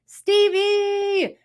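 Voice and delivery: high, ringing call of a friend's name; excited call. Real, not acted. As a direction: call out to greet a friend arriving. Greeting a friend joining.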